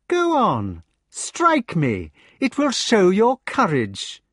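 high, icy, unhurried dare; cold taunt. A narrator's reading. Mordred dares the giant to strike, coldly. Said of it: "the same voice said".

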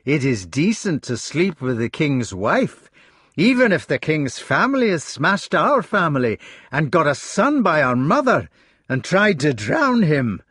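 bitter. A narrator's reading. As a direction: spit out the grievance. Bitter quarrel among brothers.

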